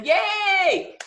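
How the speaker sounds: high, loud cheer of yay; excited cheer. Real, not acted. Finishing her share to the group, a high excited cheer.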